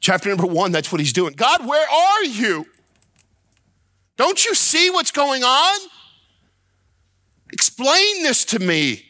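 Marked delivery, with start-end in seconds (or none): 1.3-9.0 high-pitched, anguished shout of protest; shouting at God. Acted. Preacher acts out Habakkuk challenging God, shouting. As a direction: cry out to heaven, demanding an answer. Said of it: "He's shouting at God".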